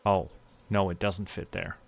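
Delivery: ordinary talk, nothing marked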